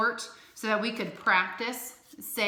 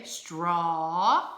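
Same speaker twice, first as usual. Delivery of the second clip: slow and drawn out; 3 semitones lower than usual. Shown as a demonstration. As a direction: stretch "straw" slowly, long aw at the end. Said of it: "You would say".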